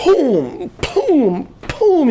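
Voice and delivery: high, drawn-out booming impact noises; vocal sound effect of ramming a door; imitated door bashing. Storyteller acting out Dan breaking the door, booming noises.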